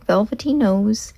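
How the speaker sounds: ordinary delivery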